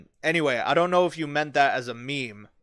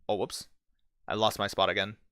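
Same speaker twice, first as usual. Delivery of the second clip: normal talking voice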